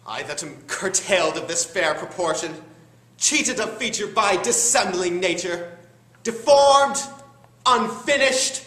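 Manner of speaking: building to a high, loud cry of resentment; bitter outburst. Acted. Villain rages at his own deformity.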